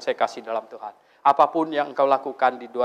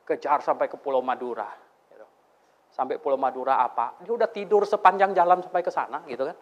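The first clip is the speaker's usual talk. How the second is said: normal talking voice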